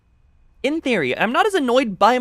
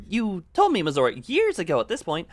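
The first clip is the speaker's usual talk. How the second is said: ordinary delivery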